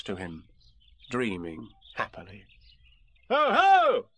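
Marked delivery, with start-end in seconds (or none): 3.3-4.0 sudden high, loud call; booming out to make someone jump. Acted. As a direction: burst out with a startling holler. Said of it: "said Christopher Robin loudly and suddenly".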